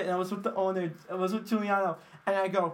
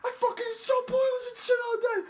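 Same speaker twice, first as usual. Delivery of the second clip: much higher, louder mocking voice; gruff friend impression; 4 dB louder than usual. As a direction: ham up the grumpy friend. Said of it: "And he just goes".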